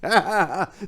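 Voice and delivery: high, full priest's laugh. Acted. Belshazzar laughing at Daniel's defiance, full and high. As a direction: laugh out in triumph. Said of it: "laughed fully and deeply".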